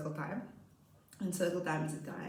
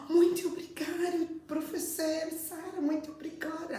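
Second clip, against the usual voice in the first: put-on high, gushing child voice; high mock-tearful gratitude; grateful pupil; 11 semitones higher than usual. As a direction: mimic a little girl overcome with thanks.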